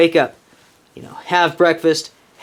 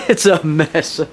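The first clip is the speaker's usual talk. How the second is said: laughing; 3 semitones higher than usual. Real, not acted. Laughing at the messy shelter.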